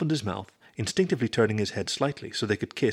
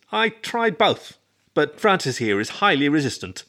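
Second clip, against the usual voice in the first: high, grinning and drawn out; 6 semitones higher, 4 dB louder than usual. A narrator's reading. Roommate tells of matchmaking attempts, grinning. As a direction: stretch it out through a grin. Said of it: "Ernie grinned".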